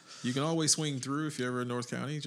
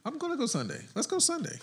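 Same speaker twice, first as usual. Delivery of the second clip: excited; 14 semitones higher than usual. Real, not acted. Echoes plan eagerly.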